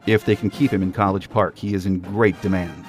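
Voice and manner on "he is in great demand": usual speaking voice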